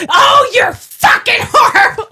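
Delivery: high, slow imitation shriek; mock outraged scream. Outraged woman seeing the bigger Trump mask, mock scream. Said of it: "And she's like".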